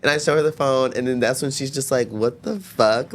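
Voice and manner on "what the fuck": very slow, drawn-out disbelief; stunned mom. Retold or imitated. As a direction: drag each word out in shock. Mom shocked seeing videos.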